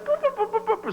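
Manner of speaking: very high squeaking boo-boo notes; squeaky clarinet. Retold or imitated. Kid squeaking on the clarinet, mocked.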